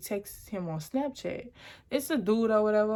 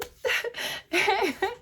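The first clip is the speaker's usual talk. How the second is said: nervous laugh; 12 semitones higher than usual. Real, not acted. Nervous laugh while retelling.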